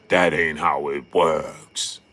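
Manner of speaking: low, slow put-on voice; mock Stephen A. Stephen A lecturing Freddie on boxing, low and slow. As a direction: drag it out low like a know-it-all. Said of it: "and Stephen A saying".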